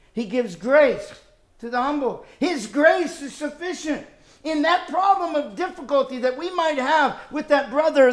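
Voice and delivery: rising pulpit fervour; preaching at full force. Acted. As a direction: lift each family member as you preach.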